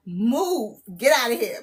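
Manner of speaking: ordinary talk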